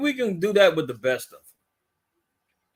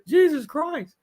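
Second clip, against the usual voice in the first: high-pitched meme kid impression; mock startled; 9 semitones higher, slower than usual. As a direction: squeal it in comic fright. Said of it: "somebody scares him".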